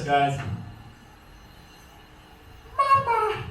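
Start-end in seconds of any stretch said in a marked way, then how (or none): 2.8-3.4 put-on high piercing baby gator "Mama" call; baby alligator call. Shown as a demonstration. Handler shows the crowd the hatchling call, high and piercing. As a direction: squeak it out like a hatchling calling its mother. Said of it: "It sounds just like this".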